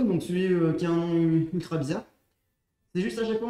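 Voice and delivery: usual speaking voice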